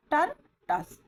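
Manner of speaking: टर, टस as two separate loud syllables; light vowel sound. Shown as a demonstration. Teacher showing students the light sound in टर, टस, split apart. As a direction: say टर with a light vowel, pause, then टस. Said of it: "मतलब light sound है".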